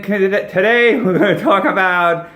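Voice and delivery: high, loud promo read over music; bright ad-read intro, loud and high; upbeat ad announcer. Acted. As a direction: sell it like an opening commercial pitch.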